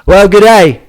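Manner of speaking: a loud, hammed-up Australian greeting; Australian accent. Host greets the audience in a mock Australian accent. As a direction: play the Aussie host, "good day" broad and Australian. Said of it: "I brought this accent".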